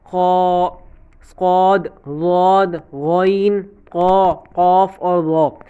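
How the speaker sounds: slow, full and heavy خا صاد ضاد غین قا قاف را; heavy letters recited. Shown as a demonstration. Teacher repeats the heavy letters slowly for students to copy. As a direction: drag each heavy letter out, thick and full. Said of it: "ان کو موٹا ہی پڑھنا ہے".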